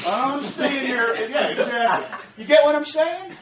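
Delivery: loud laughing brag; gleeful loud bragging; laughing boast. Real, not acted.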